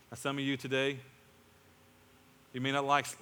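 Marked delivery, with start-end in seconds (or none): none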